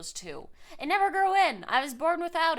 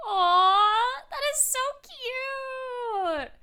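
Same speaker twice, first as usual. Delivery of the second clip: cooing aw; 11 semitones higher, 9 dB louder, much slower than usual. Real, not acted. Reacting to chat about cats.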